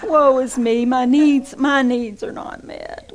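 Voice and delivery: over-the-top mock lament; mock wail. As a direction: wail it like a spoiled churchgoer. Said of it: "mocking whiny churchgoer".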